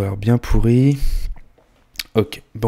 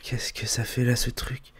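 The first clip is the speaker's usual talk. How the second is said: whispering; 2 semitones higher, 8 dB quieter than usual. Acted. Frightened whisper alone at home.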